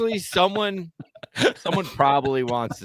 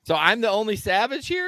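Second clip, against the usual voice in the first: mock outrage; 9 semitones higher than usual. Real, not acted.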